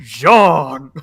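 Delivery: loud call of the name. Pretend. Crying out the character's name to the co-hosts, loud call. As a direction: call her name out loudly.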